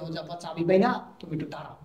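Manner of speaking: low, very soft secret coaching; feed the excuse under your breath; hushed prompting. Acted. Husband tells wife what to shout to father, hushed.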